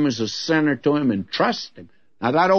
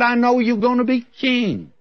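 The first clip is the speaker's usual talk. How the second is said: higher, pleading Saul; lightly voiced Saul admitting defeat; Saul conceding; 8 semitones higher than usual. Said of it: "he said".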